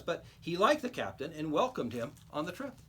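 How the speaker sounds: ordinary delivery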